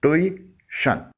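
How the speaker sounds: Tuition broken slowly into "tu-i... shun"; in two pieces. Shown as a demonstration. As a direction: split tuition with a clear pause before "shun". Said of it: "इसे दो टुकड़ों में बोलना है".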